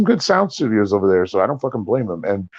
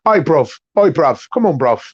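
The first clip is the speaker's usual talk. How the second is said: higher-pitched mock London roadman "bruv"; teasing imitation of young Londoners; London youth accent; 6 semitones higher than usual. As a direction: ham up the Jamaican-Cockney slang. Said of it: "who talks like".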